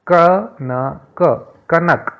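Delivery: word कनक called out high and loud, slowly; loud clear word. Shown as a demonstration. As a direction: announce कनक loudly to the class. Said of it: "शब्दों के पहले अक्षर को हमें जोर से बोलना है". (कनक ज़ोर से और धीरे बोलें)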